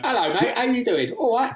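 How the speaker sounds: a cartoonish London "mate" voice; mock British accent. Friend mocking bad accent work with a high British greeting. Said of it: "Just like the".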